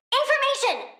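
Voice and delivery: high, thrilled exclamation; excited. Acted. Plant girl delighted at new data, high exclamation. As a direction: squeal it out, thrilled. Excited about gathering info.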